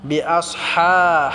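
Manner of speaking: بأصحاب slowly, its ha pressed in the throat and stretched; slow teaching demo of بأصحاب with a long pharyngeal ha; throaty, lengthened ha. Shown as a demonstration. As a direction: hold the حا of بأصحاب one alif long. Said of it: "হাটা আমরা গলায় চাপ দিয়ে এক আলিফ টেনে পড়ব".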